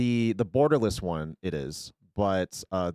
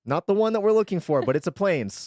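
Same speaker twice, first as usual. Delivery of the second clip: excited; 7 semitones higher, 5 dB louder than usual. Real, not acted. The wanted card turns up.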